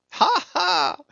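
very high acted laughter; high-pitched "ha, ha"; exultant laugh. A narrator's reading. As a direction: laugh out with wild glee. Stubb exults aboard racing ship.

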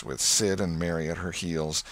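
ordinary delivery, nothing marked

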